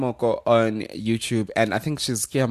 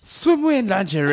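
surprised; 9 semitones higher than usual. Real, not acted. Teasing disbelief, repeated question.

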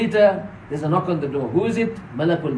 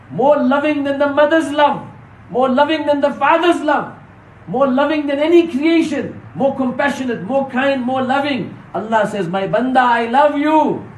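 raised, ringing sermon delivery; impassioned preaching; 6 semitones higher, 3 dB louder than usual. Real, not acted. Preacher extolling Allah's love to the congregation, impassioned. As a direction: build phrase on phrase, pitched up.

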